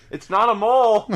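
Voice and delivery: high indignant whine; defensive teen voice. Pretend. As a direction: insist defensively. Son skit.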